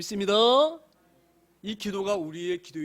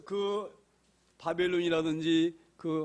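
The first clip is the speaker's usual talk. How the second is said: ordinary talk, nothing marked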